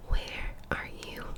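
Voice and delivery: usual speaking voice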